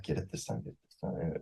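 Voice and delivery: whispered mock urging under his breath; will her on in a tense whisper; muttering get it this time to himself; hushed urging. Husband silently urging wife's take.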